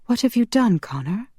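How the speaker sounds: high alarmed question, dropping off at the name. Acted. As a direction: ask in rising dread. Alarmed heroine confronts Connor.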